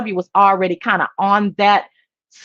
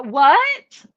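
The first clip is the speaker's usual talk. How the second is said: ordinary delivery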